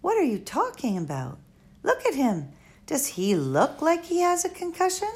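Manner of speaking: a hint of a girl losing patience; Flora. Flora pleading for the squirrel, exasperated.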